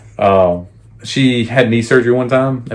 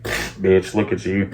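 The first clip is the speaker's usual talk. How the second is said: hushed taunt; 2 semitones lower, 7 dB quieter, slower than usual. Retold or imitated. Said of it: "I'm like".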